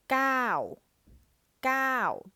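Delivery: gao with a high falling tone, said twice; Thai falling tone. Shown as a demonstration. Teacher modelling gao with a falling tone. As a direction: drop gao sharply from high. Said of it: "it's a falling tone".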